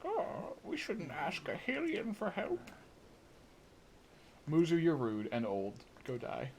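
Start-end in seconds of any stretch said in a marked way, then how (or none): 0.0-2.7 high, slow, haughty mock voice; snooty old fish. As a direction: look down your nose at the Hylians. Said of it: "This guy is racist against Hylians".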